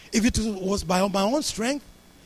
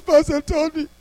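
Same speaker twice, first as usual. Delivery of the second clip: high, loud mock wail; imitated crying; 10 semitones higher, 7 dB louder than usual. Stranded man phoning his pastor, imitated loud high crying. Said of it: "Aaron calls me and he's crying".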